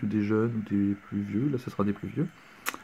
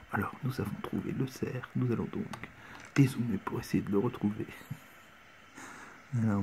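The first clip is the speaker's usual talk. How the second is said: much softer, hushed documentary narration; hunting show whisper; 2 semitones lower, 11 dB quieter than usual. Pretend. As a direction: hush it like a TV hunting host. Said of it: "je parle tout bas, ça fait plus ambiance".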